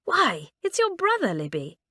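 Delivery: high, delighted surprise; a girl spotting a face at the window; surprised exclaim. Acted. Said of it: "she pulled aside the curtain and exclaimed".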